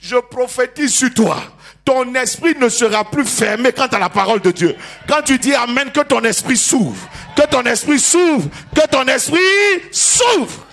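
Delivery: loud, slow, building prophecy; prophetic shout. Real, not acted. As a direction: declare it, climbing to a cry. Prophetic declaration rising.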